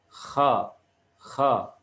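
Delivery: "Kha" with friction at the top of the throat; upper-throat kha. Shown as a demonstration. Teacher modelling the letter, "Kha" from upper throat. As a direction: scrape "Kha" near the root of the tongue. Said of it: "pangkal tenggorokan bagian atas".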